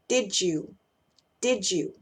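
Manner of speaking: "did you" run together as "di-jou"; connected speech. Shown as a demonstration. Teacher showing how "did you" links, blended pronunciation. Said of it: "esa combinación va a sonar".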